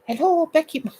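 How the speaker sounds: high, hammed-up Romanian hello; Romanian accent. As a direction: sing out a greeting in a mock Romanian accent. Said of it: "use my most famous Romanian accent".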